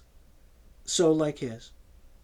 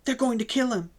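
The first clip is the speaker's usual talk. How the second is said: much higher, breaking with distress; a distraught young woman sobbing, much higher; 9 semitones higher, faster than usual. A narrator's reading. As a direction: sob it out in despair. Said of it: "she sobbed".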